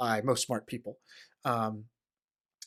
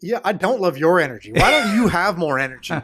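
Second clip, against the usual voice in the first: mock retort; 3 semitones higher, 6 dB louder than usual. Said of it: "And it's like".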